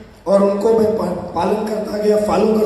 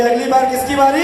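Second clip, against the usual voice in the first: rousing call. Real, not acted. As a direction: push the question up to get an answer. Repeats because crowd was not heard.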